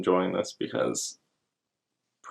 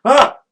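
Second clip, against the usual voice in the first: mock scream; 11 dB louder than usual. Reacting to fake explosions.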